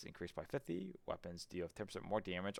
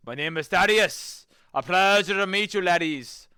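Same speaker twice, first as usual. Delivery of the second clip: loud, high Irish brogue for a new knight; hammed-up Irish knight greeting, pitched way up; Irish accent; 11 semitones higher, 16 dB louder than usual. Streamer introducing his knight character, in a hammy Irish accent. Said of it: "Oh, you're Irish".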